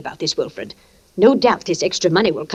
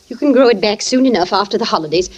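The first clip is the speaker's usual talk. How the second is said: ordinary talk, nothing marked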